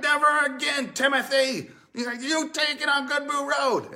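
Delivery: high, loud hammed-up yell; jilted fiancée shouting at Timothy, hammed-up; mock breakup shout. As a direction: fling the ring and yell it. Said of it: "And you're like".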